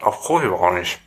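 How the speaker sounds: muffled by a mouthful; chewing while speaking; mouth full. Real, not acted.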